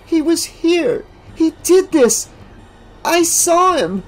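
high, slow, shaken insistence; cling to what she saw; shocked girl. A narrator's reading. Alice in shock telling classmates what she saw.

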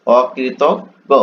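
অকৃতজ্ঞ drawn out very slowly, each sound held; slow careful pronunciation. Shown as a demonstration. Teacher to students, showing the word, very slow careful pronunciation. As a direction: stretch out অকৃতজ্ঞ syllable by syllable. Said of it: "teacher shows the word's pronunciation".

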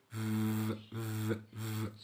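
ف- buzzed with voice as v; voiced v demo; voiced v sound. Shown as a demonstration. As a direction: hum through teeth on lip for ف-. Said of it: "بس المرة دي طلع صوت بقى".

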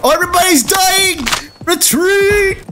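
high-pitched frantic calls; rally the squad in a panic; urgent, high-pitched gamer yelling; frantic battle cries. Real, not acted. Shouting battle calls in game.

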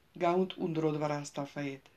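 ordinary delivery, nothing marked

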